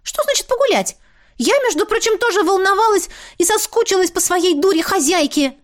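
very high, squeaky indignant voice; indignant mop. A narrator's reading. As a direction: squeal it, offended.

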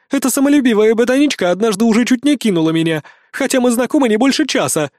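a fierce woman fed up with the nerd; indignant warrior woman. A narrator's reading. Valkyrie character voice.

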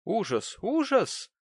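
second word leaps high and loud; dramatic, swooping exclamation; very expressive horror. A narrator's reading. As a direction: repeat it with mounting theatrical horror. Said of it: "повторила миссис Тоджерс весьма выразительно".

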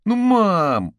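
loud, high, drawn-out begging; whining boy. A narrator's reading. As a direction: drag it out, pleading with mum. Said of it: "опять затянул пацан". (протяжное детское нытьё)

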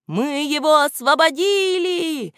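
high, slow cheering hares; hares shouting. A narrator's reading. The hares cheer the rescue: high cheer. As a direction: cheer it as the whole hare family. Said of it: "завопили зайцы все разом".

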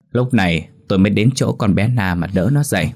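usual speaking voice

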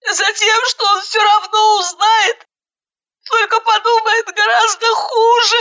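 put-on high tearful scream of a hysterical young woman; high hysterical shouting through tears; crying shout. A narrator's reading. Hysterical bride yelling at her friend, high and tearful. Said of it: "закричала Жанна".

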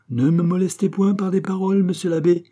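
high, sustained declamation; ringing, affronted objection; indignant protest. A narrator's reading. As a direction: protest loftily, pitch held well up. Pitou protests at being insulted.

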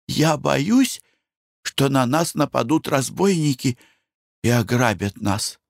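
high, slow, fearful pleading wife; a nervous wife begging; anxious wife. Acted.

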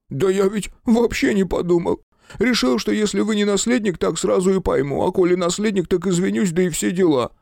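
high, pleading voice of a frightened man; nervous photographer voice. A narrator's reading. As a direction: beg and babble like a scared little man. Photographer excuses himself.

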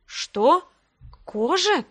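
shocked realisation, pitch climbing; startled. A narrator's reading. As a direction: question it in sudden alarm. Heroine startled to find herself undressed.

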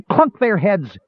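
high, eager battle cry; shrill yell. A narrator's reading. Tass eggs Caramon on in battle with a high, shrill yell. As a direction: holler it like a gleeful kid. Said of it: "Tass yelled shrilly".

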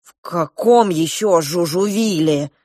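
angry. Acted. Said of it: "гневно спросил Клоп".

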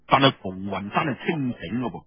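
plain reading voice

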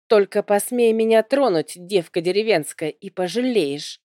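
ordinary delivery

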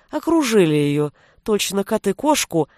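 plain reading voice

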